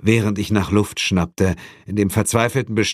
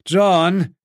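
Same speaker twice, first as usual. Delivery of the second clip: raised, high call to a partner; calling from above; 10 semitones higher, 3 dB louder than usual. A narrator's reading. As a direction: call the name down to him. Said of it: "rief Suko von oben". (von oben rufend)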